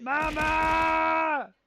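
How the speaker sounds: loud call for mama; shout. Real, not acted. Streamer calling out to mama, loud shout. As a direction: yell it out across the room.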